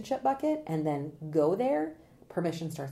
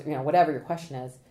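ordinary talk

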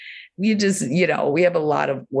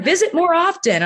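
much higher, louder chirpy invitation; cartoon vulva character; 14 semitones higher, 3 dB louder than usual. Cartoon vulva urging viewers to come back, much higher. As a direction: squeak it out like a cartoon. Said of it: "And she's like".

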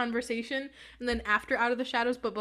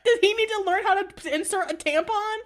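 high, loud disbelieving questions; incredulous, pitched well up; real incredulity; 9 semitones higher, 6 dB louder than usual. Real, not acted. Incredulous reaction to the book.

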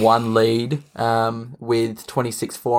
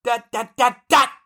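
high outraged sports pundit rant; Stephen A. Smith; 7 semitones higher than usual. Acted. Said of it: "How about we give him a Stephen A impersonation?".

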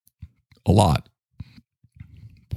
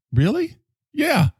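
much higher, squeaky disbelief; mock surprise; slower than usual. Host feigning amazement at a podcaster in a high squeak. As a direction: act amazed.

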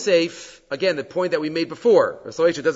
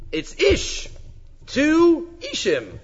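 ISH punched high and slow; stress on ish; 11 semitones higher, much slower than usual. Shown as a demonstration. Stressing the repeated word ish.

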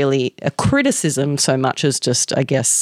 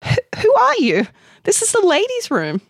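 much higher, alarmed questioning; startled stammer. A narrator's reading. Amanda confronting a man in the ladies', startled. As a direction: challenge him, stammering and high-pitched. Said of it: "stammered Amanda, keeping her distance".

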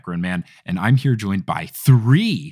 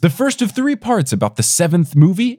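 bright announcer intro, pitched up; podcast intro announce; 7 semitones higher, 3 dB louder than usual. Acted. Host introducing episode and guests, announcer voice.

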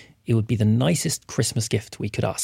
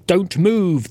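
louder, higher order to freeze; yelled; 6 semitones higher, 3 dB louder than usual. Acted. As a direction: bark it at the thief. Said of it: "the watchman yelled at the thief".

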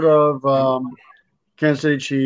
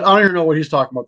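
fast, more projected restatement; repeating to be heard; 4 dB louder, much faster than usual. Real, not acted. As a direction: say it up so they catch it. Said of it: "I said".

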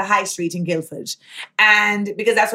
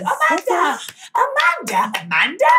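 high call of Amanda rising higher, then dropping; 7 semitones higher than usual. Retold or imitated. Imitating a fan calling her name as she runs. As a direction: call out to someone running after them.

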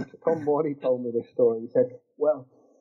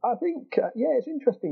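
laughing; 11 semitones higher than usual. Real, not acted. Laughing at own Arsenal joke.

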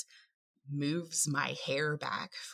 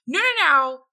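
emphatic; 12 semitones higher, 13 dB louder than usual. Retold or imitated. Retelling talk to husband.

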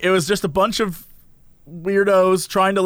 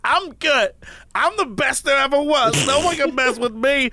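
higher, brash mock boast; lightly voiced cocky girl bragging; cocky reality-show girl; 5 semitones higher than usual. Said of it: "And she's like".

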